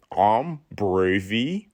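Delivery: "I'm bravey" drawn out very slowly in an odd voice; silent E sounded. Shown as a demonstration. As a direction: stretch out "bravey" with a final "ee". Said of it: "you either can say it two ways".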